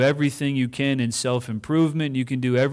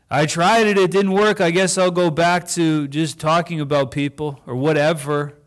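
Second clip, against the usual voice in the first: higher, louder mock whine; whiny quitter; 4 semitones higher, 5 dB louder than usual. Pastor mocking someone going back to gossip, whiny. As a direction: give up with a hammed-up whine. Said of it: "Or we could say".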